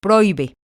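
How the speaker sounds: Prohíbe said pro-Í-be, í stressed apart from o; broken diphthong. Shown as a demonstration. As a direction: stress the í in Prohíbe as its own syllable. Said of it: "ahí dice prohibí". (Prohíbe con hiato)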